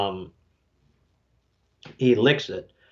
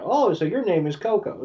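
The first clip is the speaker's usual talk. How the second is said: high-pitched, mock-enthusiastic repeat; acted friendly realization; 8 semitones higher than usual. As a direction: parrot the name back in a high voice. Ash repeats the name brightly.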